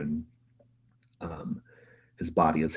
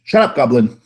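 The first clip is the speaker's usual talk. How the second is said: loud snapping shout; yelling; 8 semitones higher, 16 dB louder than usual. Acted. Voris's brother yells at the gnome, a loud snap. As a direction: bark it down at the goblin. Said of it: "He, like, yells at you".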